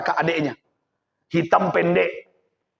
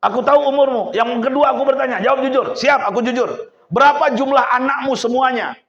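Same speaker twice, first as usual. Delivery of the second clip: stern raised interrogation; 6 semitones higher, 4 dB louder than usual. Acted. Young man interrogating.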